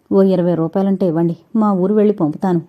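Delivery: ordinary delivery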